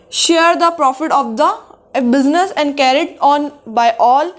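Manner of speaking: reads the English definition word by word, very slow; slow classroom dictation. Shown as a demonstration. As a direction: dictate it slowly so students can write. Teacher dictates definition slowly.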